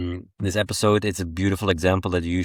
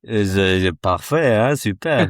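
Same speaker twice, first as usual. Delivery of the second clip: put-on Flemish French accent; 4 dB louder than usual. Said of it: "You came here for Willem's accent".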